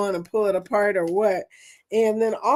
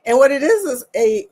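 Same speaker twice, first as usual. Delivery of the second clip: ordinary talk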